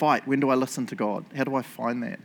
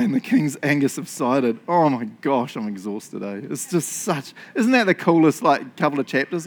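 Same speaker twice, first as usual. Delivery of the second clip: ordinary talk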